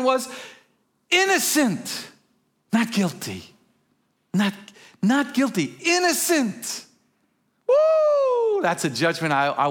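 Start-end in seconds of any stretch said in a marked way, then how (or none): 1.1-6.8 high, drawn-out cry of the verdict; triumphant proclamation. Acted. Preacher announcing the judgment on new believers, triumphant proclamation. 7.7-8.6 high, long celebratory woo; exultant whoop. Real, not acted. Preacher rejoicing at the innocent verdict, exultant whoop.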